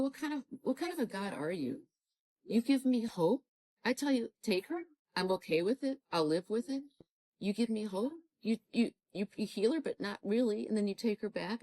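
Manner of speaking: heated retold reproach, pitched high and pressing; angry outburst at God. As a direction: demand answers from God, reliving the hurt. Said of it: "I am so angry at you".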